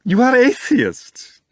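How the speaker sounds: very high, loud, slow squeal; squealing with curiosity. A narrator's reading. Stranger squealing at the two atheists. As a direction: squeal it out in delighted disbelief. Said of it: "practically squealing with curiosity".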